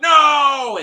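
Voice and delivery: high, loud shout of refusal; defiant shout. Retold or imitated. Asha's men refusing to surrender, defiant shout. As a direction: bellow no as the whole crew.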